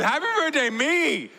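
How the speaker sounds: a laughing father mimicking his son's squeal; imitating whining toddler. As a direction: wail it like a two-year-old claiming the cookie. Toddler wants whole cookie.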